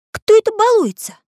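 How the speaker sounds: high-pitched, put-on questioning voice; indignant character. Samodelkin asks who nudges the plate, high indignant voice.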